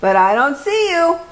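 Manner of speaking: put-on high, proud Spanish-accented swordsman; hammed-up sword fighter; bold hero voice. A narrator's reading. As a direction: announce yourself like a grand hero. Hero voice calling to friends.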